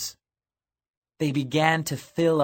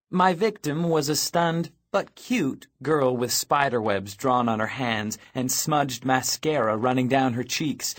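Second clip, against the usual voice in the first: ordinary delivery, nothing marked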